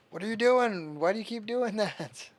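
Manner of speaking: high, loud frustrated outburst; exasperated. Real, not acted. Streamer yelling at broken alerts, high loud exasperation.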